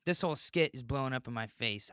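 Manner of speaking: ordinary talk, nothing marked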